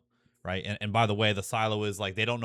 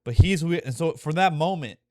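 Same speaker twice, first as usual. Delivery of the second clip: louder, faster, pushing up to a near shout; fired-up excitement; 4 semitones higher, 7 dB louder than usual. Real, not acted. Fan describing the hated father saving everyone, a fast excited near shout. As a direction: blurt it out, caught up in the moment. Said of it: "I'm getting chills".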